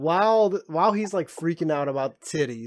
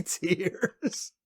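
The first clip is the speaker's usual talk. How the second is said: ordinary talk, nothing marked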